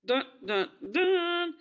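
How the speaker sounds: mock suspense tune; dramatic dun dun dun. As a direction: sing it like a movie sting.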